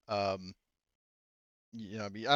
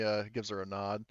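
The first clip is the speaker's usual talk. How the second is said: ordinary talk, nothing marked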